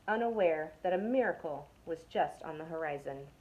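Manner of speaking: ordinary delivery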